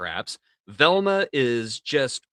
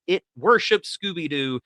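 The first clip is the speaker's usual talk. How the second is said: ordinary delivery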